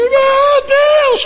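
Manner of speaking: high loud wailing cry to God; hammed-up crying out to God; mock wail. Pastor mimicking a desperate believer, loud mock wail.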